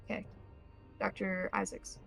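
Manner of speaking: ordinary delivery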